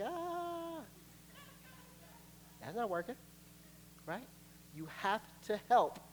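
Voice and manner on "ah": high, soft held "ah"; half-hearted little scream; thin imitation of a timid scream; feeble mock scream. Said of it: "I'm screaming. She's like".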